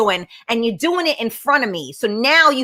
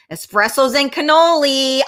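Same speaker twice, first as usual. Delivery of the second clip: usual speaking voice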